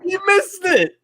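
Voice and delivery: very high, incredulous wail; disbelieving cry. Real, not acted. Fan lamenting the missed kick, high disbelieving wail. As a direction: wail the miss in real disbelief. Kicker misses field goal.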